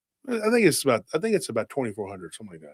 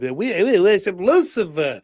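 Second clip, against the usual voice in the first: higher-pitched mocking impression; mock Abe Finkelstein; 6 semitones higher, 4 dB louder than usual. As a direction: proclaim it in a put-on higher voice. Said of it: "Abe Finkelstein said it straight up".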